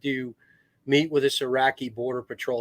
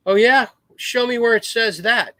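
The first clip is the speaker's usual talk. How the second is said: louder, higher acted comeback; retold cocky pushback, voice raised; defiant challenge; 8 semitones higher, 6 dB louder than usual. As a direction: imitate a Marine calling their bluff. Chandler challenging his discharge, retold.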